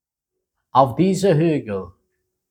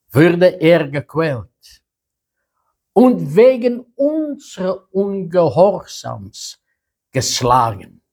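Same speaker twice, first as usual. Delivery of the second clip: raised, solemn reading voice; scripture reading; 4 semitones higher than usual. Acted. Preacher reading Isaiah to the congregation, solemn and raised. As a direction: proclaim the prophet's words to the congregation. Reads Isaiah passage aloud.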